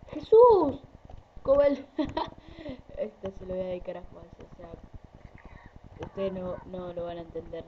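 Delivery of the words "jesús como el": sudden shocked yell; startled shout. Real, not acted. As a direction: shout it out in surprise. Startled outburst at game.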